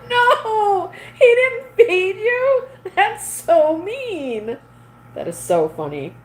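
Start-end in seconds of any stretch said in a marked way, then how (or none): 0.1-4.6 hammed-up wail, much higher and slow; fake-sob on behalf of the poor guy; mock crying. Pretending to cry because the husband didn't feed her, hammed-up sob.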